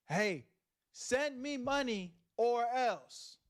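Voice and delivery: slow, high-pitched mock threat; mock money demand. Imitating a streamer threatening fans for money, mock menace. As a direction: draw out the demand with playful menace.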